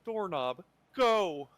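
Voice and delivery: loud, high in-character command; commanding shout. Acted. Player shouts character's spell command at the doorknob, commanding shout. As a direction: call it out big, drawing out each word. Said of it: "as I do it, though, I say".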